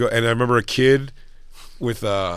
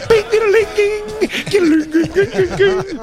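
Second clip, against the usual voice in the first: very high sung dings, laughing; mock sax solo noises; slower than usual. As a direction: imitate the endless solo. Imitating saxophone solo.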